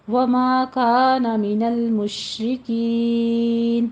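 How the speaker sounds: slow, measured tajweed chant; Quran recitation. Shown as a demonstration. Teacher recites the verse for tajweed students, slow chant. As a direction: recite each phrase slowly, drawing out the vowels.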